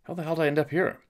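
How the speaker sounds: high, stunned "how did I get here"; baffled surprise. Retold or imitated. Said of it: "the first time was kinda like".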